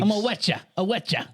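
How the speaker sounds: high mock British accent; comic British thug threat, repeated for laughs; British Wolverine. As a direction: taunt in a put-on British accent. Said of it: "He could be a British Wolverine".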